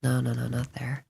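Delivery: urgent unvoiced whisper. Real, not acted. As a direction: breathe it out in a panic. Panicking at a wrong spot.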